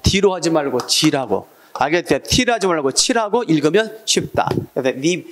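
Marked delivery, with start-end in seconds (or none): none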